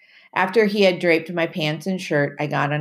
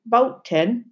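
Timothy's voice; 6 semitones higher than usual. Acted. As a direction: warn the boy like an old island sailor. Timothy's voice in the story.

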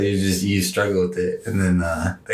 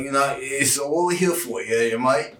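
garbled British chatter, slightly higher; mock British accent; 5 semitones higher than usual. Cohost imitates someone in the UK, garbled British accent. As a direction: mush it into fake British. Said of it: "people in the U K are like".

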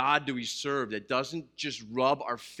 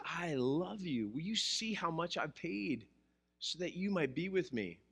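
tender; 2 semitones higher, 8 dB quieter than usual. Retold or imitated. God telling the sinner he loves them, soft and tender. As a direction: say it like a loving father, hushed. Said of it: "he says".

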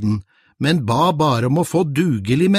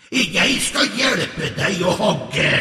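meek troll; 5 semitones higher, slower than usual. Acted. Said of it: "sa trollet".

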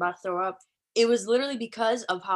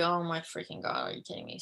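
hushed panic; 3 semitones lower, 7 dB quieter than usual. Retold or imitated. Retold panic at ticket check.

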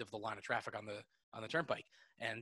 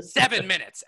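loud, high mock announcement; imitated defiant yell at a crowd; Bill Burr; 8 semitones higher, 14 dB louder than usual. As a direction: shout the countdown like a ranting comic. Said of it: "then counting the minutes".

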